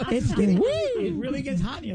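mock song quote. Quoting [name] song.

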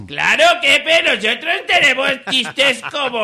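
high comic sidekick voice; Jorgito. Acted. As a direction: chime in eagerly as a high-voiced little helper. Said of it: "¿verdad, Jorgito?". (voz aguda de ayudante cómico)

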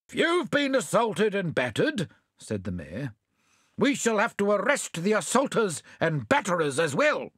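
mayor laying down the law, mildly pompous; lightly voiced mayor. Mayor to the pudding thieves, lightly pompous.

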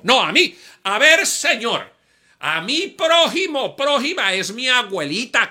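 high, loud indignant protest, slowed. Retold or imitated. Preacher protests to the Holy Spirit, high indignant protest. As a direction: argue back in disbelief.

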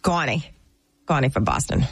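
put-on Boston accent; Connie. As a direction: introduce yourself as a Boston local. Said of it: "I could do my Boston accent".

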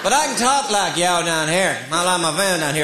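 ordinary talk

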